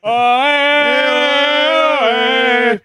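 high hammy crying out; high mock shouts. Mock accent cry continues.

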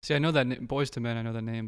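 ordinary delivery

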